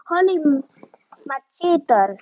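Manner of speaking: ordinary delivery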